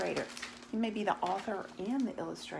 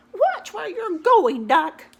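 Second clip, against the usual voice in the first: very high, loud, slow scolding hen; squawking chicken scold; 11 semitones higher, 13 dB louder, slower than usual. Acted.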